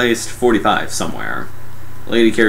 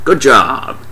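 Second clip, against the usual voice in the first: higher mock congratulation; sarcastic praise; 4 semitones higher, 4 dB louder than usual. Mocking the bed-sheet fix, sarcastic. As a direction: praise them with obvious sarcasm.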